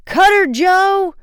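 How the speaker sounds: high, loud, slow drawn-out shout; urgent shout. A narrator's reading. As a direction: yell across the water to the helmsman. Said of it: "Frank shouted".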